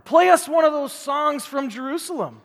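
loud sneering demand for a song; mocking captors. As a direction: taunt the exiles to sing. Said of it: "the people there were mocking them".

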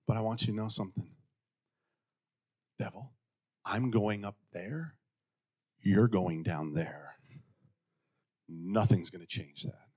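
low, soft, deliberate warning; quiet defiance. Acted. Believer telling the devil his fate, quietly defiant. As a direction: drop low and soft with total certainty.

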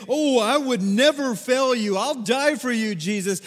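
high, loud boastful promise; braggadocious boast. Retold or imitated. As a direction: puff up and swear loyalty. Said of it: "had been so braggadocious".

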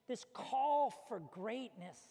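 ordinary delivery

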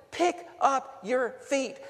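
slow, high call of encouragement; saints' call. Retold or imitated. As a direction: call out slowly, urging. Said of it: "the saints keep telling me".

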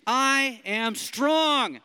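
slow, loud and high affirmation; loud declaration. Shown as a demonstration. Preacher leads the room in declaring, slow and loud. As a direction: shout it like you believe it. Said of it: "say 'em like you believe them".

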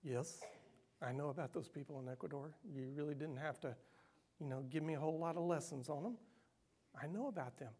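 a soft, fast, lightly amused God. Retold or imitated. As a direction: answer like God gently amused, quick and hushed. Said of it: "And God laughs".

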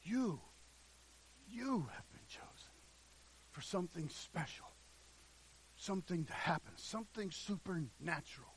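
very soft, pausing between phrases; quiet, solemn assurance; hushed, intimate preaching. Real, not acted. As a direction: drop right down and address each listener. Preacher drops to address each listener.

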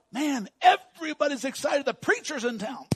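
high, excited retold thought; naive excitement. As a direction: proclaim it as big happy news. Retells his naive excited thought.

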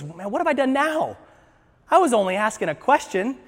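whiny protest of the wronged youngest sibling; protesting little brother. Acted. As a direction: play the put-upon kid. Acting out young David protesting.

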